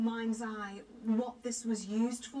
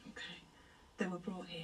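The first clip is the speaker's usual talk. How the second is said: hushed, low whisper; 6 semitones lower, 9 dB quieter than usual. Real, not acted. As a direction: barely breathe the words. Hushed during ghost contact.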